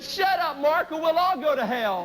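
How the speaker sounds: high, urgent hushed plea; a panicked older brother begging, pitched high; frantic big brother. Retold or imitated. Older brother in the cinema trying to silence Mark, high and frantic. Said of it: "my brother leaned over my mother and he said".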